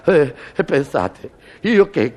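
normal talking voice

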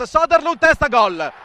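loud, rising goal call; breathless italian goal commentary. Real, not acted. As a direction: shout out the header and goal.

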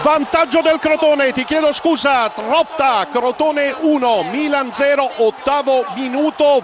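loud, urgent goal update; loud report. Real, not acted. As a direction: shout the update over the noise. Reporter breaks in loudly. (aggiornamento gridato)

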